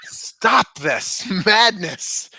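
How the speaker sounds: slow, laughing plea to stop the madness; mock dramatic plea. Pleading with fans of the fake account, slow and laughing. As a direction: drag it out through a laugh.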